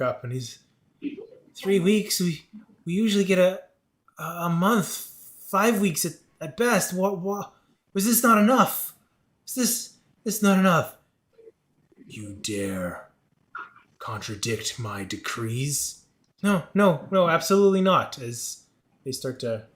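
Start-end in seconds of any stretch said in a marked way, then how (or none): 1.6-11.0 high, loud, stammering protest; alarmed protest. Acted. Villager protesting a shortened deadline to a lord, alarmed. 12.1-16.0 low, slow, drawn-out rebuke; menacing creature lord. Acted. Lord rebuking a villager who questions decrees, menacing. 16.4-18.2 high, loud, rapid denial; panicked backing down. Acted. Villager hastily appeasing an offended lord, panicked. Andy backs down before lord.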